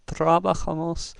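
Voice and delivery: trabajamos with the stress up front on "tra"; wrong stress, first syllable. Shown as a demonstration. Teacher showing a second wrong way, stress on TRA. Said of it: "it's not trabajamos".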